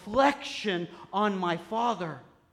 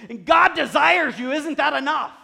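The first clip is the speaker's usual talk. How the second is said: impassioned appeal; 8 semitones higher, 13 dB louder than usual. Real, not acted. Preacher's impassioned rising appeal.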